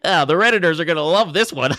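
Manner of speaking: loud, high silly voice; hammed-up gleeful parody, laughing at the end; mocking internet commenter. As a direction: crow it in a high put-on voice. Mocks chat in a put-on voice.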